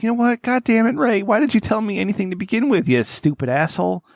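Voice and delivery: mock outburst. Retelling an outburst at Ray.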